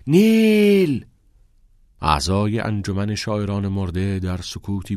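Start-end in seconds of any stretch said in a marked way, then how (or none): 0.1-1.1 long, high, loud drawn-out shout; calling out. A narrator's reading. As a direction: cry after the departing car. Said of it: "فریاد کنان صدا زد".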